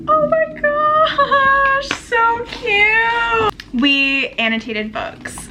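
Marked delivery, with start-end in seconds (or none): 0.1-3.5 high, drawn-out squeal; gushing delight. Real, not acted. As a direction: coo and squeal over something adorable. Gushing over a gifted bookmark.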